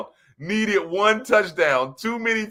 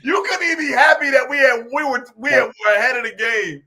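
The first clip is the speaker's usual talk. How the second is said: exasperated retort; 6 semitones higher than usual. Retold or imitated. Retelling his reply to a gloomy friend, exasperated shout. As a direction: fire back in disbelief at the complainer. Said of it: "I was like".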